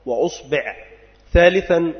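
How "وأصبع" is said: أصبع with damma on the hamza and kasra on the ba, uṣbiʿ; vowel demo. Shown as a demonstration. Teacher listing the variant for students, careful uṣbiʿ. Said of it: "ضم الهمزة".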